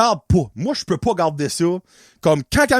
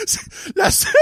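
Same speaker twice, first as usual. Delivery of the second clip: breaking into high laughter mid-sentence; words squeezed out through laughing; laughing hard; faster than usual. Real, not acted. Laughing at his own blood-draw story, words wheezed out. Laughing fit after punchline.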